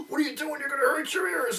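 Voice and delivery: high-pitched scolding mom voice; imitating mother. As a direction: play the worried mother scolding her kid. Mother scolding child in story.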